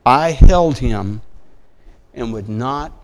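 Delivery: loud, ringing recital of the verse; impassioned scripture reading. Acted. Reads Song of Solomon aloud.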